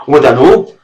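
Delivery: put-on loud cheeky child's retort; defiant boy. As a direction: throw it back at him.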